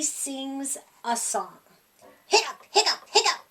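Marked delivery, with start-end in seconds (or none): 2.3-3.4 playful hiccup noises; high hiccups. Acted. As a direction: pop out three hiccups. Pete hiccups three times.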